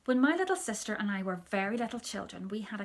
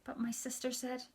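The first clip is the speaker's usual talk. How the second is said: ordinary delivery, nothing marked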